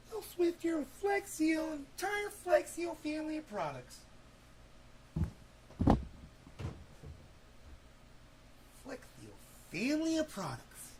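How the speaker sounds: high, far-off put-on pitch voice; mock announcer. Vlogger spoofing a product ad, hammed-up high voice. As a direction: ham it up like a cheesy ad.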